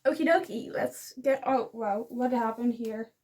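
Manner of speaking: ordinary talk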